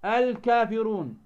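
slow "الكافرون" with a clear l; drawn-out model "الكافرون", lam heard; demonstrated lam. Shown as a demonstration. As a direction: sound the lam distinctly before ك in "الكافرون". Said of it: "ولا تظهر معه".

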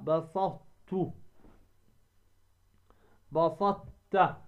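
very slow "بسطت", heavy T held into a light t; emphatic ta merged into ta. Shown as a demonstration. Teacher drilling the merge, slow بسطت with unreleased ط. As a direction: stop on ط of بسطت without bouncing it. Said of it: "تدغم الطاء ولا تقوم".

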